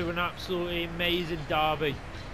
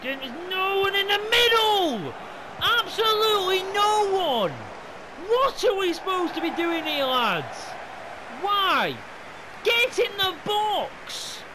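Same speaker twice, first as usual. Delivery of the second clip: high, very loud frustrated shouting; exasperated yelling; 7 dB louder than usual. Real, not acted. Gamer shouting at his team for an empty box, exasperated yelling. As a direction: bellow at useless teammates, really exasperated.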